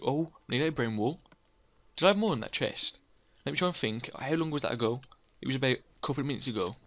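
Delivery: ordinary talk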